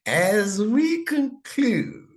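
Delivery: high, loud and very drawn-out; singsong announcer's flourish; grand sign-off. Real, not acted. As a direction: stretch it out like a showman closing up. Teacher theatrically announces the close.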